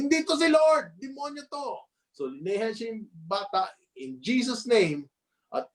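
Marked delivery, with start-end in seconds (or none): 0.0-1.9 much higher, rising cry of rage; furious outburst. Retold or imitated. Preacher acting Dowie rebuking the doctor's words, furious high cry. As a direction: hurl it out against the devil. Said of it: "yung galit na galit siya".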